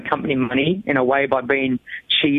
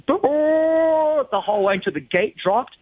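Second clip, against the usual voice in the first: slow, drawn-out, much higher; stretched out; 11 semitones higher, slower than usual. Retold or imitated. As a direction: drag it out like the endless burnout. Holding burnout whole board.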